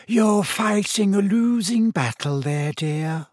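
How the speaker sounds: slow, high wheezy talking mirror. A narrator's reading. Mirror warns boy he's fighting a losing battle, wheezy. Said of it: "said his mirror in a wheezy voice".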